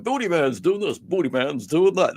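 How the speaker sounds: lightly imitated commentator chant; mimicking commentators. Retelling wrestling commentary, mildly mimicking the announcers. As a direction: echo the announcers' catchphrase, half-mocking. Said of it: "They must have said it fifty times".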